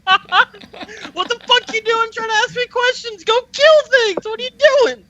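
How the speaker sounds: high, loud mocking rant; mock angry yelling. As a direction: yell at the player in a squeaky voice. S0 mocks character scolding player.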